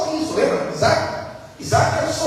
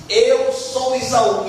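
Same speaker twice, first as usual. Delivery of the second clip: slow insistent lie; 2 semitones higher, much slower than usual. Retold or imitated. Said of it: "ele diz".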